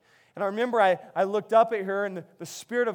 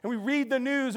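normal talking voice

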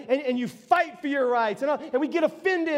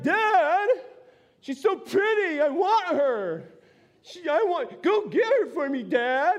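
put-on higher, whiny spoiled child begging his dad; sulking whine; 6 semitones higher than usual. Samson begging his father for the girl, sulking high whine. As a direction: pout and whine like a kid denied a toy. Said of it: "he's just sulking".

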